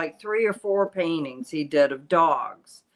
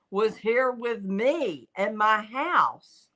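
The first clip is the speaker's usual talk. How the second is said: ordinary delivery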